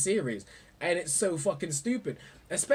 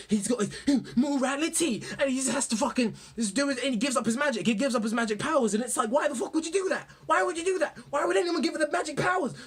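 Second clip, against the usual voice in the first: higher, rising exasperation; exasperated rant; 8 semitones higher than usual. Real, not acted. A fan ranting at the hero's choice, rising and higher. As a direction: build the disbelief. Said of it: "ranting about Edward's morality".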